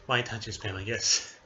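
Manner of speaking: ordinary talk, nothing marked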